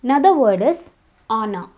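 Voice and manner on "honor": honor starting on the vowel; silent H. Shown as a demonstration. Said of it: "It's not honor".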